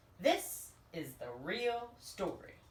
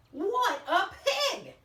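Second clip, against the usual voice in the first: slow, louder, higher mock outrage; indignant; 10 semitones higher, 7 dB louder, much slower than usual. Wolf outraged at the stingy pig, slow and loud. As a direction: spit it out in hammed-up disgust.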